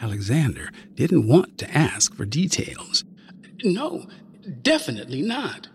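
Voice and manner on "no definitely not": put-on nervous boy. Boy answers dragon.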